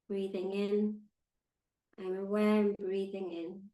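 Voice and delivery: calm, unhurried meditation guide with long pauses; measured, soothing meditation voice; guided meditation. Acted. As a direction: guide them gently into stillness, leaving space between phrases.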